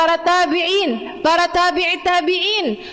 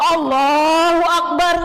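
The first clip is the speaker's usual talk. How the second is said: slow takbir shout; 3 semitones higher, slower than usual. Retold or imitated. Umar's slow prayer takbir.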